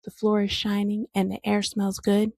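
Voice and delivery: ordinary talk, nothing marked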